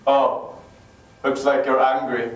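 low-pitched, unimpressed father voice; stern dad. Acted. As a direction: drop low like a dad having none of it. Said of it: "That would never work with my dad".

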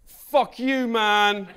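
loud, high, gleeful retort; triumphant comeback. Acted. Comedian finally delivering the zinger he never said, gleeful shout. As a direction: fire back at him with glee.

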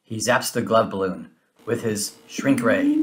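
plain reading voice

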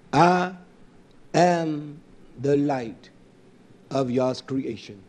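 slow, measured recitation; solemn scripture reading. Real, not acted. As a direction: let each word land. Scripture quoted slowly.

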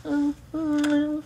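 mocking girl voice. Mocking a girl warning her friend, high and squealing. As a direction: shriek it like a grossed-out girl gossiping. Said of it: "And the girl's like".

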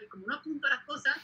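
ordinary talk

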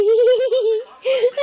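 loud high giggle; giggling burst. Real, not acted. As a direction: giggle freely. Laughing with baby.

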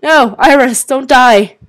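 loud, high cry of protest; alarmed plea. Real, not acted. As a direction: call out urgently to stop it. Game character seems in danger.

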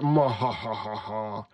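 low, slow cartoon villain laugh; deep, drawn-out theatrical villain chuckle; evil laugh. A narrator's reading. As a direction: roll out a mock-menacing cackle. Villain laughs after boasting.

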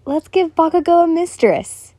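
enthusiastic. Acted. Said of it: "enthusiastically said".

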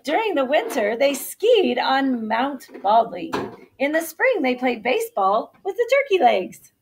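loud, lively storybook narration; reading to children. A narrator's reading. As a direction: read it out big and animated for kindergartners. Reads story to kindergarten.

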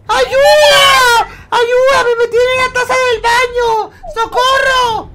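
high screaming falsetto for help; woman voice. Pretend. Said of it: "hago voz de mujer".

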